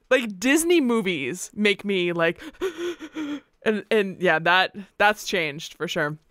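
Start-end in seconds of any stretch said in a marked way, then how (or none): 2.5-3.4 high, soft, breathy gasp of feeling; mock emotional gasp. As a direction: gasp as if moved to tears. Overwhelmed by Disney movies.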